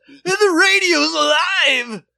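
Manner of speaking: very high, drawn-out yell; hammy ad shout. Retold or imitated. Retelling the radio microphone ad, slow high excited yell. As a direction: belt it out slowly like an over-thrilled ad guy. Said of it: "and he goes".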